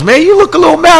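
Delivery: a hint of the woman host noticing his mood; woman host voiced. Radio host to her guest, lightly voiced and high. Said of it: "she goes".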